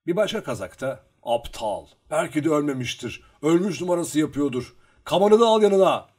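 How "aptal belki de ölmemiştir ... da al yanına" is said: building to a loud, high shout; calling out. A narrator's reading. Another Cossack warning Luka to take his dagger, calling out. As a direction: call a warning, louder towards the end. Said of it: "diye seslendi".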